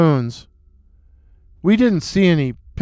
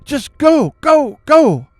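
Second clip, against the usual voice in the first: high, loud, insistent command; urgent shouting; 9 semitones higher, 4 dB louder, slower than usual. A narrator's reading. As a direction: urge them on in alarm. Frightened friend urges flight while running.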